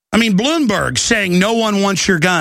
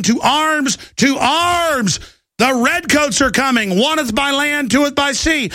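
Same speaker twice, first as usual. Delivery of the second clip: high, raised calling-out voice; rallying cry; 7 semitones higher than usual. Retold or imitated. Host as Paul Revere riding through the night, rallying cry. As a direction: cry the alarm like a night rider. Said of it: "Why is he raising his voice?".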